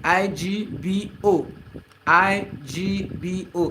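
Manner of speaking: slow letter-by-letter spelling. Shown as a demonstration. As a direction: spell each letter slowly and separately. Spelling the word for viewers.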